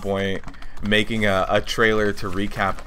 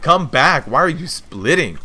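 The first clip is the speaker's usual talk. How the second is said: retold yell, high and louder; imitating a yell; 7 semitones higher, 6 dB louder than usual. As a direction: call him back in mock outrage. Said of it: "the guy started yelling at him".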